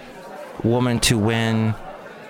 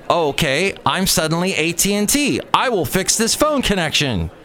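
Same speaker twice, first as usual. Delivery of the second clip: sarcastic mock reply; 6 semitones higher than usual. Sarcastic retort to producers.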